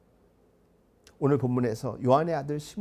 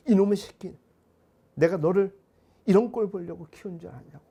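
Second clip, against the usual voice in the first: put-on loud scolding yell at a naughty child; angry parent yelling; 3 semitones higher, 7 dB louder than usual. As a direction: bark it out like a furious parent. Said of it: "화를 내는 경우도 있지만". (화난 부모의 큰 호통)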